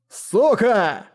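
a narrator's contained angry bark. A narrator's reading. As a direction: snarl the insult at an enemy. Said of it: "рявкнул он".

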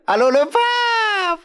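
high, slow, crying call; hammed-up tearful plea; mock cry to the pope. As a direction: wail down the phone at the pope. Calls the pope.

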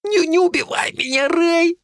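high, stammering, strangled plea; terrified lad begging for his life; barely squeezed out. A narrator's reading. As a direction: force each word out through a choked throat. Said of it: "выдавил он еле-еле".

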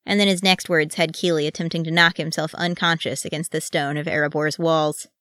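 plain reading voice

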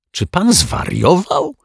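high, slowed, incredulous question; choked-out disbelief. A narrator's reading. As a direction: splutter it out in shock, high pitched. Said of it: "wykrztusił detektyw Pozytywka". (z niedowierzaniem, wysokim głosem)

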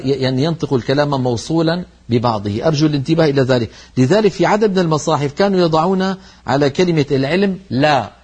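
ordinary talk

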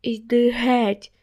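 slow, pushing-away shout; yelled. Acted. Lilia crying at Max to go away, slow shout. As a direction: drive him off with a slow cry. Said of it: "закричала на мене".

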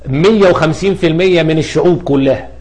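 ordinary talk

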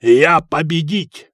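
slow, loud victory cry; triumphant. A narrator's reading. Hakon winning the dice game, triumphant. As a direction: crow it slowly and loudly in triumph. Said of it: "торжествующе сказал Хакон".

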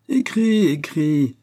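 high, loud taunting order; insistent shouted urging; goading command. A narrator's reading. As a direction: egg them on gleefully. Masked man goading the report writer.

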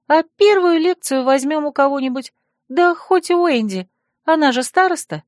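high-pitched, reassuring friend; helpful friend. Acted. Friend suggests borrowing notes from the class monitor, reassuringly.

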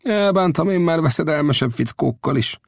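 waving off the danger with contempt; dismissive boast. Acted. Old Death scoffs at the thugs to the smith. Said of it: "megvető kézlegyintéssel".

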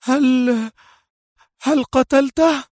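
high voice, horrified cry; horrified exclaim. Acted. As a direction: blurt it in dread. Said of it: "وهي تهتف".

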